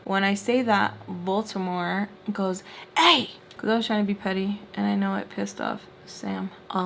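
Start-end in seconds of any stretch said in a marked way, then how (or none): 3.0-3.3 loud, high mock "hey"; retold sharp, raised cry; indignant call-out. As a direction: shout a short objection, imitating someone. Retelling Baltimore's offended call-out.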